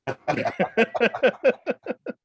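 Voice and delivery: high bursting laugh; big laugh. Real, not acted. Laughing at Marv memory.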